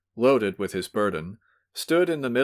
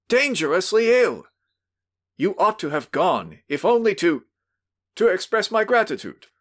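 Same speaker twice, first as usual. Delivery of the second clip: furious scolding; 10 semitones higher, 4 dB louder than usual. Acted. Host keeps shouting at drunk.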